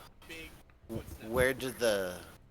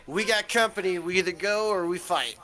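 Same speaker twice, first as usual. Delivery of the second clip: urgent call; 4 semitones higher, 6 dB louder than usual. Acted. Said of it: "and be like".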